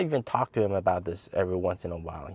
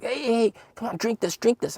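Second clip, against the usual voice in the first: fake-helpful urging; 12 semitones higher than usual. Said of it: "I was like".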